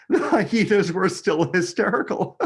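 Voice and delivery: light hyena giggle; laughing hyenas. A narrator's reading. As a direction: let a laugh break through. Hyenas still hysterical.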